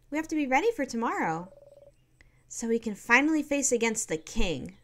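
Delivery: a hint of a chirpy young girl; cheerful girl. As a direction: play the upbeat friend waking a sleepy pal. Voicing Mirabelle's dialogue.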